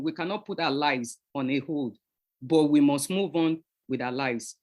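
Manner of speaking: ordinary delivery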